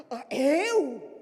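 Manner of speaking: startled, loud, odd-voiced "eu?"; mock surprise. Member asked to pray, startled mock surprise. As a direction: play the shocked member.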